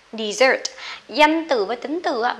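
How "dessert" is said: Dessert with the second syllable punched, de-SSERT; stress on second syllable. Shown as a demonstration. Teacher models the verb form, Dessert stressed on second syllable. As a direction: hit the last syllable of Dessert louder. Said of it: "Âm hai nhá".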